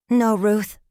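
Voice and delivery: plain reading voice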